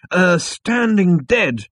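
slow, high, halting correction; hesitant. Acted. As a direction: grudgingly accept the fix. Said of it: "continued Zaphod".

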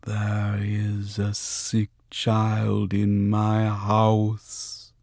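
very slow, deep, thick spirit voice; possessed voice. A narrator's reading. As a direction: drag each word out like something else speaks. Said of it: "deep and slow and weirdly glutinous".

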